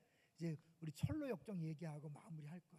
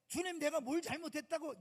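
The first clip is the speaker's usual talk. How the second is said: mock complaint; 8 semitones higher, 8 dB louder than usual. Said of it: "이런 소리 하면 안 돼".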